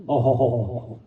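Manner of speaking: drawn-out low "ohoho" of pleasure; low mock sigh of delight. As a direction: hide the pain behind a low satisfied moan. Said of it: "તો હું બોલ્યો".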